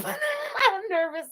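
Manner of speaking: high, slow giggly admission of nerves; nervous laugh. Real, not acted. Laughing off nerves to her viewers, high and slow. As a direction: laugh it out, still rattled.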